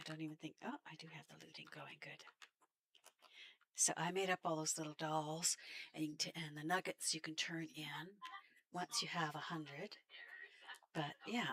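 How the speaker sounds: ordinary delivery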